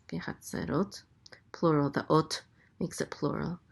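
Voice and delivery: usual speaking voice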